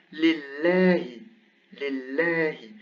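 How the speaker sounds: lillahi drawn out with a light L and fronted "ae"; non-emphatic lam. Shown as a demonstration. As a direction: say lillahi with a thin, clear L. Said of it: "because there is a kasra before".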